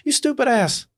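high, contemptuous name-calling; scornful insult. Retold or imitated. At the window, sneering high at the shoveling neighbor. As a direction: sneer down at her. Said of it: "And I'm like".

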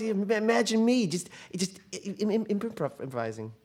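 trips over the word and deflates; faltering stammer. Acted. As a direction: confidence collapsing into stuttering. Man stumbles over the word.

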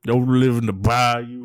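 slow, hammed-up Cajun drawl; mock Cajun bayou man; Cajun accent. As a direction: play a swamp-dwelling Cajun, slowly. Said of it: "and he's Cajun".

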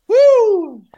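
high, loud whoop. Real, not acted. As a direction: whoop at the burn. Spice hits hard.

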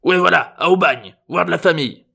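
high, loud, cranky grandpa; old man blustering on. A narrator's reading. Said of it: "reprit le vieux".

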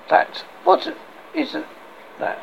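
normal talking voice

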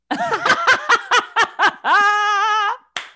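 high, loud burst of helpless laughter; big real belly laugh at a punchline. Real, not acted. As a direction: explode into a long, high-pitched cackle. Reacts to the punchline.

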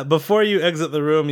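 normal talking voice

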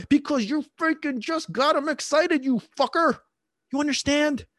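high, heated mock telling-off; exasperated scolding. Mock scolding of the imagined owner.

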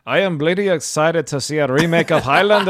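a hearty mock Scottish accent, loud and high. Cohost hams up a Scottish accent about Highlander. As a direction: play up a jokey Scottish brogue for your co-host. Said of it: "the best Scottish accent you're gonna get from me".

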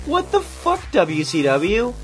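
high, drawn-out incredulous cry; baffled, slow what-the-hell; exasperated disbelief. Real, not acted. Baffled outburst at WCW booking.

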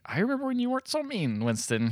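lightly voiced wife, starting high; a gentle mock reproach, high then settling; mock chiding wife. Wife chiding grumpy Winston, lightly acted high voice.

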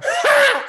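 very high burst of laughter; imitated laugh. Imitating everybody else in the room laughing, mock cackle.

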